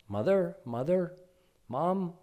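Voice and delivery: put-on high, pleading little boy's call; child calling mother. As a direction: tug at her with your voice like a small child. Child Krishna tugging, calling mother.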